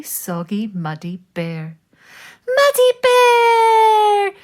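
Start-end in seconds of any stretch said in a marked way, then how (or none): 2.5-4.3 very high, loud, drawn-out cry of the name; joyful cry. Acted. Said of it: "cried Moose".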